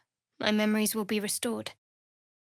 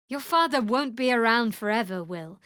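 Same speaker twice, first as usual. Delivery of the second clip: ordinary delivery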